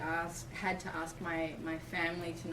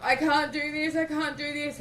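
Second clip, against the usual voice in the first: high, louder desperate plea; retold fear; 8 semitones higher, 8 dB louder than usual. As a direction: beg yourself in rising fear. Fear seeping in during labor.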